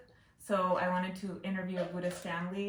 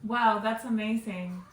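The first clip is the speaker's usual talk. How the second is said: usual speaking voice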